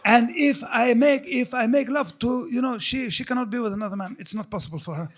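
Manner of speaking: imitating a Middle Eastern accent, bragging, laughing; friend's accent. Mocking his friend Tawfiq's bragging in a Middle Eastern accent. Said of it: "That's how he used to talk".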